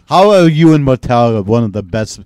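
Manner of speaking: loud, indignant burst; mock outrage. Demanding answers from a metal drummer, loud mock outrage. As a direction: call him out at full volume. Ranting at [name] as if present.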